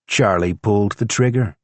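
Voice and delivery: plain reading voice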